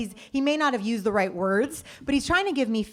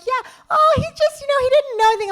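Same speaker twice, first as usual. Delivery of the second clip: very high and loud, dismissive; mock high clueless voice; naive partner; 8 dB louder than usual. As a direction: brush it off cluelessly. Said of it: "And he's like".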